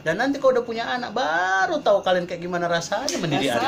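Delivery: high-pitched, exasperated mock mother; mother's admonition. Mother warning her children about raising their own, exasperated. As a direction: imitate a mother warning what parenthood brings. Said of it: "Ibu saya itu bilang".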